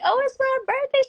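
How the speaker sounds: very high, giddy announcement; high, giddy retelling of her birthday; excited birthday. Retold or imitated. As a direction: squeal it like a happy birthday girl. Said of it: "I'm like".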